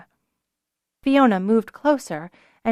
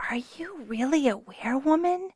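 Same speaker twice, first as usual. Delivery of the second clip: put-on whispered, high little child; a tiny awed girl whispering; timid little girl, hushed; small girl whisper; 6 semitones higher, 5 dB quieter than usual. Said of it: "the little girl asked".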